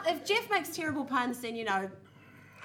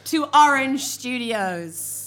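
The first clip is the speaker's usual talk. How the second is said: announcer; 2 semitones higher, 9 dB louder than usual. Acted. Host's opening spiel.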